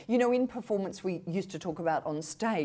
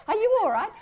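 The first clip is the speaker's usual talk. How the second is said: squeaky, much higher and louder worried Aussie coworker; a bemused colleague checking you're okay, pitched way up; high mock concern; 13 semitones higher, 11 dB louder, faster than usual. Australian coworker puzzled by the put-on voice, high mock concern. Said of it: "they'd say".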